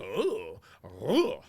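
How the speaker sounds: imitated rapper grunt, jumping in pitch. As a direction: throw out rap ad-lib grunts. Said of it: "sound like [name]".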